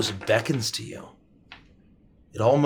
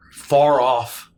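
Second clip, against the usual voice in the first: ordinary talk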